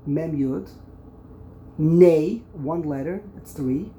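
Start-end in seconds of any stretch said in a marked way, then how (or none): 1.8-2.4 "Ne" punched out loud, set apart; isolated syllable. Shown as a demonstration. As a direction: call out "Ne" as a counted syllable. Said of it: "You break up the word".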